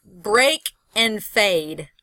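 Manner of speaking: "break and fade" slowly, leaning on the vowels; slow vowel demo. Shown as a demonstration. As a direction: stretch "break" and "fade" to show the shared vowel. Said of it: "it's all the sound of the vowel".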